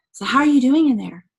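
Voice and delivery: loud, projected check-in; calling across room. Retold or imitated. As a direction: call out to someone shut behind a door. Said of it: "says from the front of the room".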